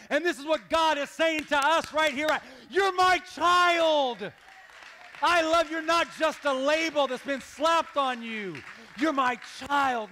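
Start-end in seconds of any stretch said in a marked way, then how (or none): 2.7-10.1 loud, high, drawn-out preacher's cry; impassioned declaration. Acted. God saying to us right here, impassioned shout. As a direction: proclaim it at full force, stretching the words.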